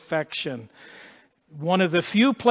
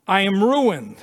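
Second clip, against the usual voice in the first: crying out; 3 dB louder than usual. Retold or imitated. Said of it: "He cries out".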